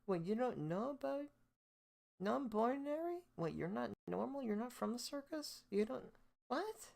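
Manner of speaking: hammed-up know-it-all acting baffled; mocking disbelief. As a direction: sneer at them in a shrill fake shock. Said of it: "And so they're like".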